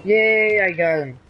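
high, loud celebratory cheer; excited cheer. Real, not acted. Gamer celebrating getting the enemy, excited cheer.

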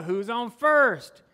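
loud, drawn-out comic bit; hammed-up vaudeville exchange, loud; Abbott and Costello routine. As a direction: belt it out like a baffled comedy duo. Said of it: "I'm like the old Abbott and Costello".

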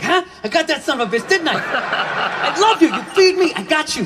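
high, proud dog voice; eager loyal dog. Acted. Dog looking up at its owner, proud eager voice. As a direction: seek praise as the devoted dog. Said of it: "Dog's looking up at me like".

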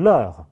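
"l'heure" as one syllable, vowel of la dropped; elided article. Shown as a demonstration. Teacher shows la plus heure contracting, said as one joined syllable. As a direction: glide the l straight into "l'heure", no break. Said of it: "拉和heure之间是需要省音".

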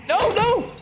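high, sharp panicked no; panicked cry. Real, not acted. Gamer watching a character fall, high panicked cry. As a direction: cry out in alarm.

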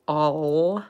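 "all" drawn out with an exaggerated sound; overdone L. Shown as a demonstration. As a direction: stretch "all" slowly as the way not to say it. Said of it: "No é tipo".

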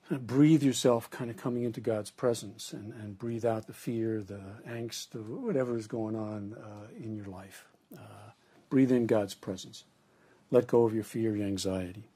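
soft, sinking guided breathing. Shown as a demonstration. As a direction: breathe it out gently, fading away. Guided breathing into God's presence.

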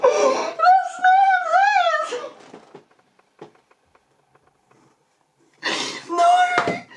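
high, helpless laugh-cry squeal; can barely get the words out for laughing; crying with laughter. Real, not acted. Reacting to the cartoon's joke, wheezing laugh-cry.

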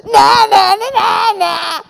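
high, loud, jeering chant; yelping taunt. A narrator's reading. Friend leaping from the wardrobe, loud yelped taunt. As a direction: crow it like a playground taunt. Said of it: "yelped Ralph".

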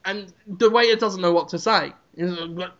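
usual speaking voice

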